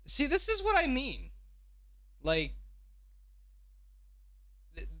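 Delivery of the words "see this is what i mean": higher, exasperated: see, this is what I mean; fed up and pointing it out; exasperated rant. Real, not acted. Streamer fed up with prank videos, exasperated.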